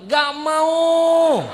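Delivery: put-on high, loud childish refusal; whiny refusal. Student refusing his teacher, high and loud.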